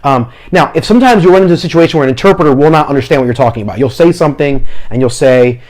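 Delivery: ordinary talk, nothing marked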